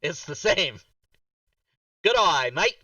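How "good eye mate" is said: "Good eye, mate" as a playful Australian "g'day mate"; Australian accent. Shown as a demonstration. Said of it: "how I learned to do an Australian accent".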